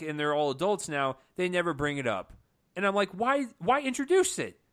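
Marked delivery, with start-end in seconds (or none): 3.1-4.6 high-pitched, incredulous complaint; exasperated questioning. Acted. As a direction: plead for an explanation, pitched high. Acts out frustrated reaction to plot.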